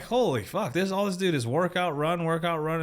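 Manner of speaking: a touch of wide-eyed amazement, retold; retelling his shock at a recruiting video; amazed exclamation.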